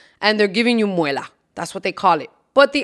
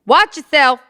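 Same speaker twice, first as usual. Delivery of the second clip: stern warning; 5 semitones higher, 6 dB louder than usual. Retold or imitated.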